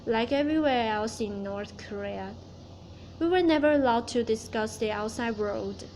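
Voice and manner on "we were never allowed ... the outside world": ordinary talk, nothing marked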